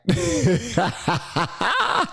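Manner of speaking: high, rising cackle of amusement; amused laugh. Real, not acted. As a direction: burst out laughing at the joke. Laughing at the clip.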